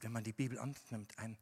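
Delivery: ordinary talk, nothing marked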